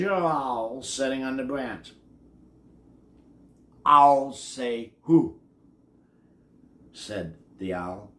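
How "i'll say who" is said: slow drawn-out owl voice. A narrator's reading. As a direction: stretch it like a wise old owl.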